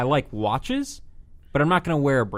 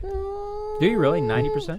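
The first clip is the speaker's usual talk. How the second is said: high, squeaky disbelief; incredulous. Real, not acted.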